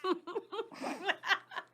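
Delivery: bright high laugh. Real, not acted. Laugh at own act.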